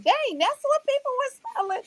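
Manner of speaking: high, startled outcry; sudden disgusted realization; shocked realization. Retold or imitated. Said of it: "I'm like".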